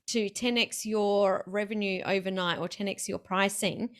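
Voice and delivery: usual speaking voice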